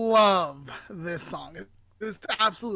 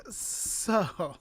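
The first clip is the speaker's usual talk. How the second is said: ordinary delivery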